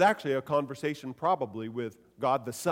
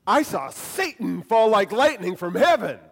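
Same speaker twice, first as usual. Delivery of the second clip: raised and loud with joy; excited; 4 semitones higher, 6 dB louder than usual. Shown as a demonstration. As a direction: echo their excitement back. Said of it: "just reflecting their excitement".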